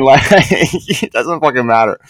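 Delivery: laughing. Real, not acted. Laughing at world-domination joke.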